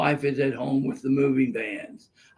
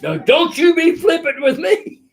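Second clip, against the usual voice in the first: scolding judge; 13 semitones higher, 9 dB louder than usual. Retold or imitated.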